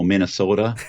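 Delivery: Great Lakes vowels on Minnesota; Minnesota accent. Shown as a demonstration. Actor illustrates a regional dialect for the interviewer, Minnesota accent. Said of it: "those kind of vowels like that".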